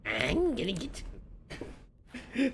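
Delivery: high, gleeful gremlin voice; squeaky little gremlin menace. Pretend. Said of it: "I'm gonna stop with the gremlin voice".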